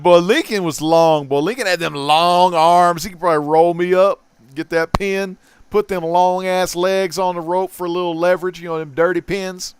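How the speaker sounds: loud, hyped wrestling talk; excited. Real, not acted. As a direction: get fired up like a wrestling fan. Excited wrestling talk about the cover.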